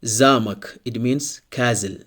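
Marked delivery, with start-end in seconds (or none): 0.0-0.8 "замок" as ZA-muk, first syllable stressed. Shown as a demonstration. Teacher models the word for castle, "замок" stressed ZA-muk. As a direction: hit the A in "замок", reduce the ending: ZA-muk. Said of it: "Here the stress is on letter A".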